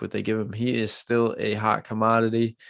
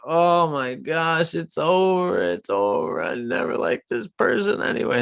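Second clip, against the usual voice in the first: mock panicked fan; 6 semitones higher than usual. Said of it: "and you're like".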